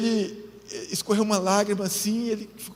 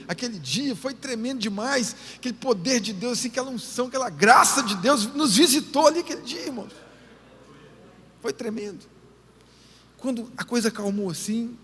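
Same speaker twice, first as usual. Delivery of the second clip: ordinary talk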